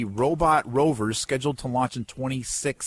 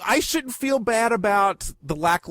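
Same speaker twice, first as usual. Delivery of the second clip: ordinary talk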